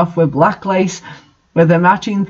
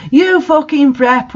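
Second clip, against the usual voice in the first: slow, higher hammed-up insult; mock angry yell; 7 semitones higher than usual. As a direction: spit out the insult in mock fury. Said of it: "we all yielded angrily".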